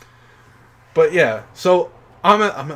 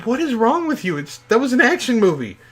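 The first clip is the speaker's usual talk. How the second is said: higher-pitched, mock-baffled parents; 6 semitones higher than usual. Parents asking their crying son, lightly baffled. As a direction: play the puzzled parents, lightly. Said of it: "my dad and my mom both looked at me and went like".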